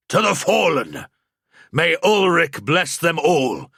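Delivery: a soldier's loud, solemn toast; rousing toast cry. Acted. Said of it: "Morgenstern cried".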